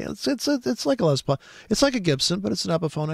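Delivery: high, excited voice of an eager buyer. Retold or imitated. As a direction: play the thrilled guitar buyer, pitched high. Voicing an eager guitar buyer.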